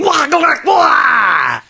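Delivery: high, loud strangled yelps; cartoon wails of being flattened; comic cry of alarm. A narrator's reading. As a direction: holler like you've been pounced on. Police cry out when jumped on.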